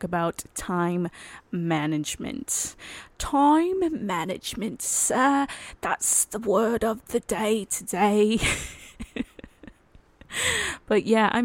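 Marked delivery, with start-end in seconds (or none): 3.2-8.4 higher, slow put-on voice; hammed-up game show reveal; mock announcer. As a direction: proclaim the topic like a pompous host.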